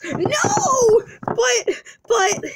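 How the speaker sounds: high, wailing protest; wailed, pleading objection; play-acted distress. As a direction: cry out in hammed-up dismay. Wife protests the divorce.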